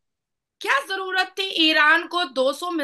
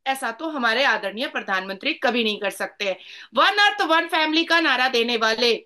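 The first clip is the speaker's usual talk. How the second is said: ordinary delivery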